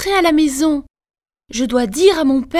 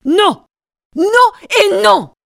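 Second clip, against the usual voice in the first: loud, higher furious no; furious shout; 6 semitones higher, 4 dB louder than usual. Acted. Queen screams at the mirror in fury. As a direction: scream it in rage. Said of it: "crie la reine".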